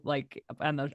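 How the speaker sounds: ordinary delivery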